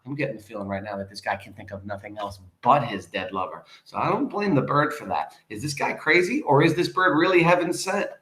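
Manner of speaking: ordinary delivery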